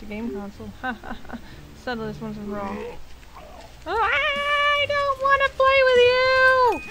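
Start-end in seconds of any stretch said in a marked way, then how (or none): 3.9-6.8 high, slow, whiny squeak; a little creature sulking and refusing; whiny character voice. Acted.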